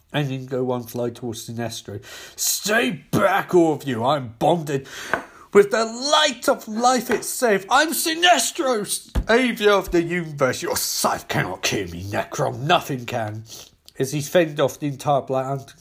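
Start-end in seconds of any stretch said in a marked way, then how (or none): 2.4-13.4 defiant hero. Acted. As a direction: declare it grandly to the enemy. Sinestro defies Necron in battle.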